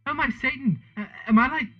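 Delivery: high-pitched, halting question; gasped. A narrator's reading. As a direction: gasp it, slowing towards the end. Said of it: "I gasped".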